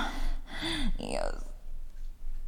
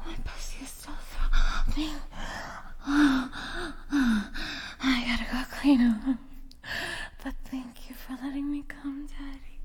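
afterglow whisper; 4 semitones higher, 6 dB quieter than usual. Acted.

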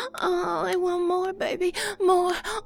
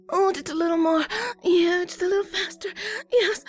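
usual speaking voice

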